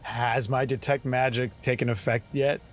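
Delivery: usual speaking voice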